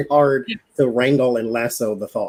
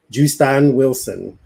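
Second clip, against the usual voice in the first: JUSTIN WILSON drawn out slowly, Cajun-style; Cajun name imitation; 3 dB louder, slower than usual. Fan naming the Cajun cooking-show host, Cajun accent. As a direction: say the name with a stretched bayou accent. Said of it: "that's how they say it down in".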